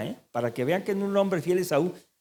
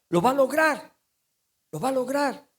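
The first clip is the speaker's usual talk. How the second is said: "lograr" ringing high and firm; emphatic high warning; 10 semitones higher than usual. Acted. Preacher warns those who chase things alone, ringing emphatic. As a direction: drive home that they will succeed.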